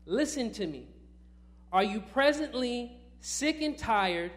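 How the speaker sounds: preacher reading out with weight and deliberate pauses; scripture proclamation. Acted. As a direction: declare it to the congregation in strong phrases. Reads own scripture translation aloud.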